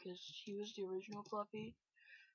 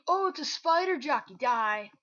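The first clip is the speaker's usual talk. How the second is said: very loud yell, starting high; alarmed shout; 5 semitones higher, 17 dB louder than usual. Real, not acted. Gamer attacked by a spider jockey, loud alarmed shouting.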